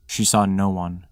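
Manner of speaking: plain reading voice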